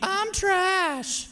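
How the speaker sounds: high, squeaky little toy's moan; mock Forky whine with a laugh. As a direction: play the sad toy fork. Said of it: "you are not Forky".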